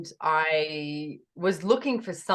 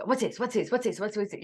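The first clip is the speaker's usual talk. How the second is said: high, repetitive string of questions; retold eager questioning; pestering questions; 5 semitones higher than usual. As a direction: pester like a curious child, pitched up. Acts out her many questions.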